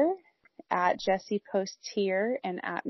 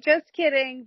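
cutesy high and loud; sing-song cute tease; 6 semitones higher, 5 dB louder than usual. Retold or imitated. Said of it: "try to be cute and be like".

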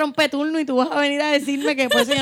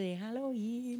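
hushed advice; 16 dB quieter, much slower than usual. Retold or imitated. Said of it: "como la amiga de".

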